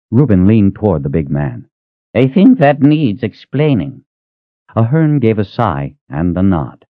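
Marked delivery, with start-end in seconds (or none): 2.1-4.0 put-on high, slow, prickly old man's voice; demanding old man. Reuben pressing the big man to explain, slow and prickly. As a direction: insist like a cranky old man.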